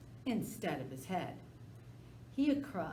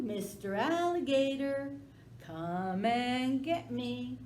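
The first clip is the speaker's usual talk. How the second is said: high, loud sing-song taunting; dog taunting the alligator loudly; teasing taunt; 5 semitones higher, 4 dB louder, slower than usual. Acted. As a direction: taunt him from the porch. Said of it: "he teased Alligator".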